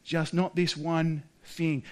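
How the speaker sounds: a kind voice that names the one limit; God's gentle permission. Acted. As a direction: lift the first part, then ease off softly. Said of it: "When God says".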